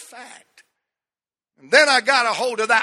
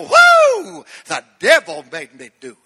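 Reverend Flip impression; 5 semitones lower, 6 dB louder than usual. To the church, mimicking the TV preacher's whoop. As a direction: whoop it up like the old TV comic preacher. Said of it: "Anybody remember Reverend Flip?".

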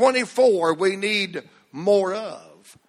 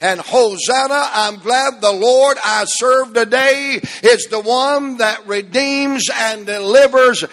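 full-force preaching; 3 semitones higher, 5 dB louder than usual. Real, not acted. Pastor stirring the congregation on Palm Sunday, preaching hard. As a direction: build the church up to praise.

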